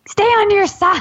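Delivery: high-pitched playful order, breaking into a laugh; teasing command, pitched high; mock snap. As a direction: shoo him off, laughing. Pushing husband away playfully.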